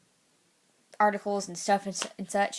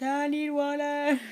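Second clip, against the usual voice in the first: parched plea; 6 semitones higher, slower than usual. Pretend. Mock plea for water.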